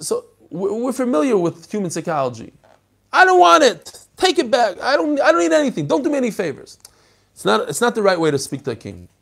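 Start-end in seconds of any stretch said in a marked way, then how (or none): 3.1-6.8 high, loud, offended refusal; indignant protest. Acted. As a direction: throw the gift back at the king. Mephibosheth acting out his protest.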